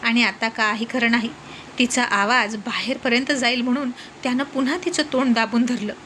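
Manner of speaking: plain reading voice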